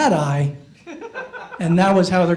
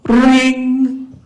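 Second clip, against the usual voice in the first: high, louder imitated phone ring; 6 semitones higher, 4 dB louder than usual. Shown as a demonstration. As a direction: sing out the ringtone. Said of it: "let me show you what it's like".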